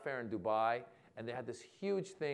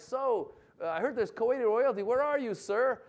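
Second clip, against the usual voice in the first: raised, louder stage voice; calling out to crowd; 9 semitones higher, 5 dB louder than usual. Retold or imitated. Comedian on stage seeking royalty, calling out. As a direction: project it high to find someone in the room.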